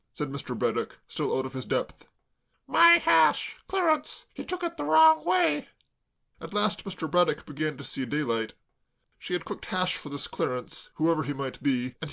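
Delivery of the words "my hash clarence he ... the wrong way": put-on much higher, slow wretched girl; tearful girl. Claire sobbing to Braddock, high tearful wail.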